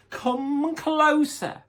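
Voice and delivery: high, very slow coaxing; sweet coaxing wolf. Acted. Wolf luring Little Red closer, slow and sweet. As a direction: draw the child in slowly and sweetly. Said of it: "said the wolf sweetly".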